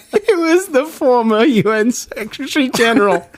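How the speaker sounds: high pitch, laughing through the reading; can barely get it out for laughing. Real, not acted.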